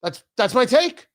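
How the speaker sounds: ordinary delivery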